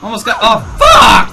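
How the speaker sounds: building to a high, loud curse; alarmed shout. Real, not acted. Player hit mid-sentence, shouted curse. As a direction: break off into a yelled swear.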